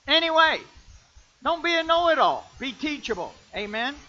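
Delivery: loud, high sermon call; full-force preaching. Acted. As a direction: shout the warning out.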